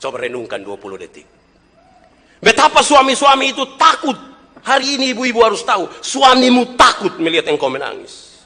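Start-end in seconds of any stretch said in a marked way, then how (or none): 2.4-8.3 high, loud preacher's cry; fiery sermon voice; full-force preaching. Acted. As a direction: drive it home to the congregation.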